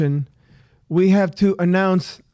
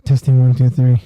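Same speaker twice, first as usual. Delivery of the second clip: mic check; 7 semitones lower, 6 dB louder than usual. Acted. Mic test in deep voice.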